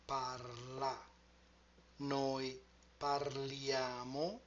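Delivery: each form of "parlo", "parli", "parla", "parliamo", "parlate", "parlano" spoken slowly with clear Italian vowels; slow Italian conjugation. Shown as a demonstration. Teacher drilling the forms of parlare for students, slow and clear. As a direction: lay out every ending of "parlare" slowly and cleanly, one by one. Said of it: "What changes are the endings".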